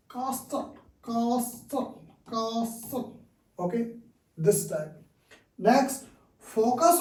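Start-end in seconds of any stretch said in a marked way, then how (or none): 0.1-3.2 castle said softly, t left silent, kassle; castle with no t sound, spoken quietly; silent letter demo. Shown as a demonstration. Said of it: "Learn silent letters in words like".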